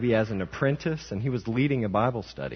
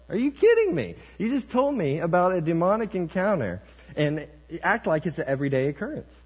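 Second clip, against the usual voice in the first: high, loud mock disbelief; exasperated, high-pitched imitation of disbelief; incredulous; 9 semitones higher, 4 dB louder than usual. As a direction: protest in astonishment, pitch shooting up. Retold disbelieving reaction to pastor.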